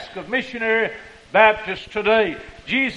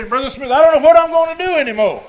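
retold fretting, higher, louder and quick; anxious pastor imitated; 6 semitones higher, 8 dB louder, faster than usual. Anxious pastors confiding in Brother Smith, mock-fretful. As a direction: voice a worried pastor's rushed, raised plea. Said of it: "preachers are anxious about things".

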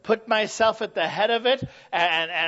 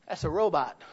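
ordinary talk

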